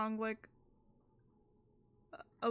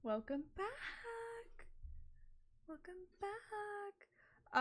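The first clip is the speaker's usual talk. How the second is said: eerie slow whisper; 8 semitones higher, 8 dB quieter, much slower than usual. Pretend. Soft creepy drawn-out greeting.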